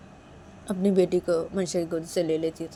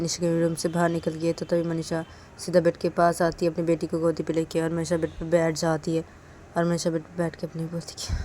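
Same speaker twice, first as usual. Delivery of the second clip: plain reading voice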